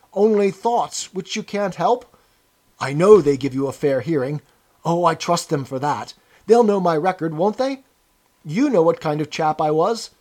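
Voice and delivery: anxious appeal, tags rising for agreement; eagerly appealing. A narrator's reading. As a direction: plead for reassurance from an old chap. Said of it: "began eagerly appealing to him".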